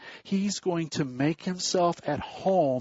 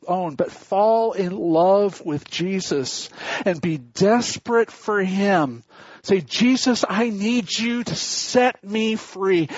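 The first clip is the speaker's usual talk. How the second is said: louder, slower fervent preaching; preaching at full force; 5 semitones higher, 5 dB louder than usual. Acted. Preacher urging the congregation to love Jesus, full-force preaching. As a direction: build and plead with the flock.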